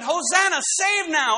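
normal talking voice

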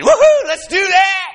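high, loud, slow whoop of mischief; the sin inside you whooping, high and loud; gleeful inner tempter. Pretend. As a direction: voice the sinful urge cheering itself on. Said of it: "something inside you saying".

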